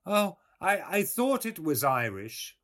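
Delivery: put-on high, loud old countrywoman; old milkwoman. A narrator's reading. Old woman answers the visitor about the language, high and loud. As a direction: pitch it well up, an eager old woman.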